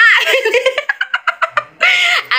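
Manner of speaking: high giggling burst of laughter; real laugh. Real, not acted. Fan cracking up at her own impression, high laugh.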